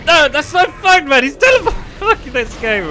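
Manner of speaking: high, loud protesting wail; real exasperated gamer rant; outraged yelling. Real, not acted. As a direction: cry foul, loud and high. Outraged at teleporting enemy.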